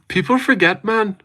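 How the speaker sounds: put-on high-pitched stab at a British accent; attempted British accent. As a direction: try it in a squeaky British accent. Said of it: "I couldn't say it".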